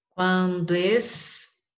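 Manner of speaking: Quando es- said slowly, syllable by syllable; teacher counting syllables aloud. Shown as a demonstration. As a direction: split Quando es- into slow beats. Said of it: "Faz aí pra mim".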